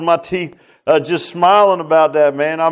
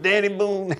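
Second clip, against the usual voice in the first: softly slurred name, a bit higher; slurred stroke speech; 5 semitones higher, 5 dB quieter than usual. Retold or imitated. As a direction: lightly imitate a slurred voice. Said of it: "his words are slurred a little bit".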